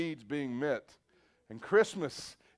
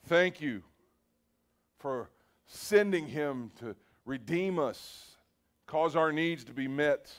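closing prayer. Acted. Pastor leads bowed congregation in thanks, earnest prayer. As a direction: pray it from the heart, gently leading the room.

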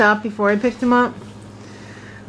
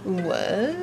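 soft muffled what; 5 semitones lower, 9 dB quieter than usual. Shown as a demonstration. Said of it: "there's a difference".

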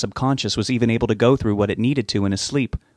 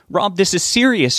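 higher, urgent shout; 9 semitones higher, 3 dB louder than usual. Acted. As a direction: raise the voice in alarm. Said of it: "she shouted".

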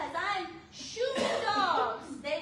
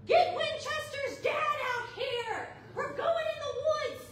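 high, loud shouted order; screaming; 10 semitones higher, 3 dB louder than usual. Retold or imitated. At the neighbor, high urgent screaming. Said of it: "I scream".

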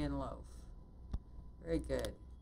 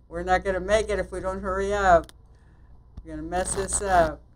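loud, higher-pitched rush of alarm; raised, pressured voice; urgent worry; 4 semitones higher, 13 dB louder than usual. Real, not acted. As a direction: fret loudly that time is running out. Racing the clock in game.

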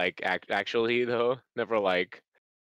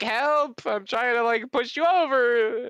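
high, strained mock cry for help; hammed-up helpless pleading, pitched way up; struggling little rabbit; 9 semitones higher, 3 dB louder, slower than usual. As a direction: squeal it like someone too small to push. Acts tiny rabbit struggling to shove.

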